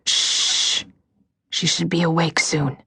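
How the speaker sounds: airy hushed whisper; breathy whisper. Acted. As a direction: shush them in a breathy whisper. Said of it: "Breathy voice again".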